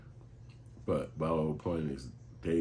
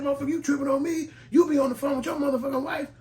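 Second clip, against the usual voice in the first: much higher and louder, yelling back at John; angry shout; 9 dB louder than usual. Retold or imitated. Worker yelling at coworker John, much higher angry shout. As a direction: blow up at the coworker.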